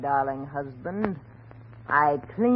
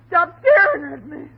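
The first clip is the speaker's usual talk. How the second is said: loud, high cry of alarm; panicked demand; 7 semitones higher, 5 dB louder than usual. Acted. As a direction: burst out in frightened protest. Wife snaps, louder second take.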